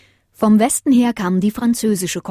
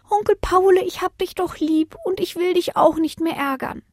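put-on much higher child voice, sweet apology; a little pup making up; small child; 11 semitones higher than usual.

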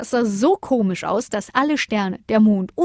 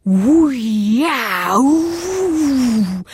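loud, swooping, drawn-out howl; high whooping wail; howling little whirlwind; 5 semitones higher, 5 dB louder, much slower than usual. A narrator's reading. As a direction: howl like a gusting little wind. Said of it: "heulte der kleine Wirbelwind".

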